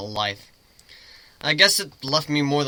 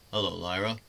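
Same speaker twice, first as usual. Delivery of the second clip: a slightly lower, put-on voice; changed voice; 5 semitones lower, 6 dB quieter than usual. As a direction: speak as a mysterious stranger, lightly disguised. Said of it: "Change my voice up".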